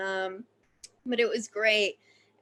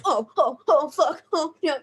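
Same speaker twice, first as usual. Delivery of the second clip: louder, higher flustered yelps; mock panic; 5 semitones higher, 5 dB louder than usual. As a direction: act out a frantic little disaster. Acting panic at a wet cast.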